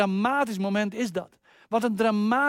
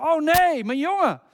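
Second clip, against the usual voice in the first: put-on high, loud cry of dismay from an old father; Isaac crying out on realizing he blessed the wrong son; 8 semitones higher, 6 dB louder than usual. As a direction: call out in shock, much higher and louder. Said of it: "hoort hij zijn vader roepen".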